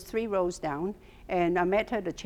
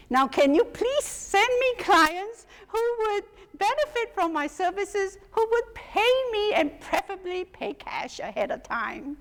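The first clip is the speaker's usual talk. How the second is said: pleading prayer; 10 semitones higher than usual. Retold or imitated. Praying to God for clients.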